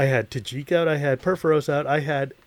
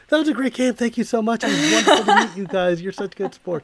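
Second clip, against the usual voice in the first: high, sugary polite thank-you voice; overly sweet politeness; 8 semitones higher than usual. Retold or imitated. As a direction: gush like a gracious loser, pitched up. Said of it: "we were like".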